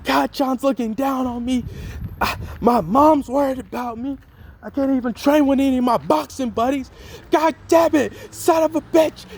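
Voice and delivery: Deku losing it. Retold or imitated. Deku breaks down over his worried mom, high strained cursing. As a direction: let frustration boil over into cursing. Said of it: "He's like".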